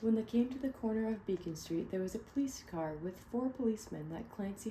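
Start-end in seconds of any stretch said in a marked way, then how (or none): none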